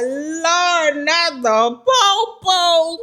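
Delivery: high, slow cry of dismay. Retold or imitated. Hearing police sirens, a high slow wail of dismay. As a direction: wail it like the worst has happened. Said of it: "I'm like".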